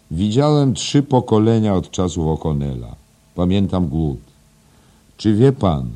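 put-on opinionated old schoolmaster. Voices Mr Deasy in story.

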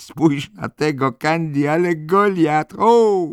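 bardzo wysoki, głośny, przeciągły okrzyk; zachwycone zdumienie. Acted. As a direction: wykrzykuj z podziwem, coraz wyżej i głośniej. Sandy marvels at the giant. (rosnący pisk zdumionej dziewczyny)

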